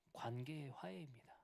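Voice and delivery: whispered key phrase. Acted. Preacher naming the next reconciliation in a whisper. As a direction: say it under your breath, weighty.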